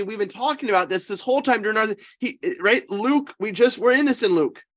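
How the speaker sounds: normal talking voice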